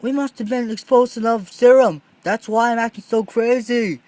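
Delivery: loud high alarmed explaining; excited realization. Acted. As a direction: rush the realization out. Jimmy realizing love serum.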